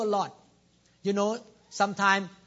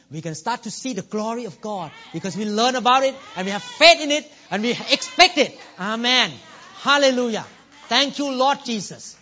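rising preaching shout; 4 semitones higher, 9 dB louder than usual. Real, not acted. Preacher builds to a climax.

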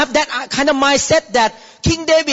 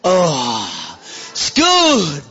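tasting delight; 6 semitones lower, much slower than usual. Pretend. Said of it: "put food in your mouth and chew".

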